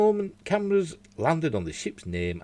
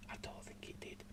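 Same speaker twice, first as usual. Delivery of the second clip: whispering; 25 dB quieter than usual. Real, not acted. Whispered aside while reading.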